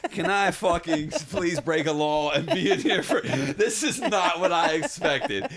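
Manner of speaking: hammed-up pleading with a laugh in it; mock desperate plea. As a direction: act out a frantic plea, half laughing. Retold panic at dad arriving.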